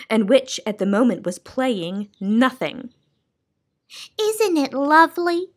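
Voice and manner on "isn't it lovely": high, dreamy sigh of delight; wistful high lady's voice; sighing Soundkeeper. Acted. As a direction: savour the silence she loves. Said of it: "she sighed".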